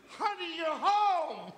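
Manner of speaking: high, soft, drawn-out squeal; mock delighted wife. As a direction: imitate an overjoyed wife, high and slow. Mock wife greeting him at night.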